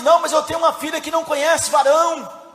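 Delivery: normal talking voice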